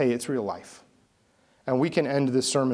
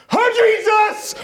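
terrified scream; 8 dB louder, slower than usual. Retold or imitated. Terrified passenger screaming mid-flight.

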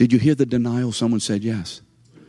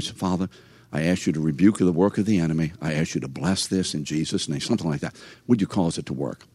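quiet prayer; 6 semitones lower, 5 dB quieter than usual. Retold or imitated. Retold prayer over the machine.

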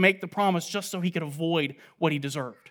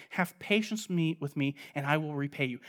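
hushed, lower pleading; pleading softly; 3 semitones lower, 7 dB quieter than usual. Acted. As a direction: beg quietly for mercy. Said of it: "began to plead with him".

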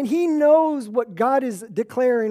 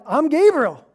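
louder, higher, playfully smug self-introduction; cocky Gabriel; 5 semitones higher, 6 dB louder than usual. Retold or imitated. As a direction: brag like you know you're important. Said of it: "Gabriel here is like".

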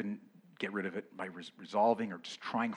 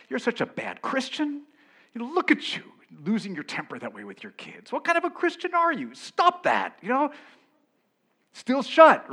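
a shrill, raised voice berating yourself, much higher; self-scolding; 11 semitones higher, 10 dB louder than usual. Retold or imitated. Preacher voicing the guilty inner critic, scolding loudly. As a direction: nag yourself like a disappointed scold. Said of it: "guilt and shame ourselves".

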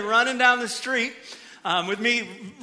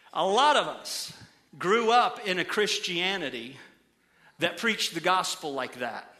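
ordinary talk, nothing marked

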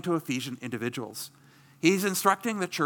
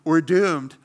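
a louder, hammed-up cry of doom; mock panic; 2 semitones higher, 7 dB louder than usual. Preacher mocking a panicked church, louder mock alarm. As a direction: play at panicking, half-joking. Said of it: "not by going".

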